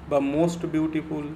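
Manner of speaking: ordinary delivery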